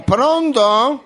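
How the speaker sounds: high, loud sing-song "pronto?"; phone answering voice. Pretend. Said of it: "Ma perché lei risponde così?".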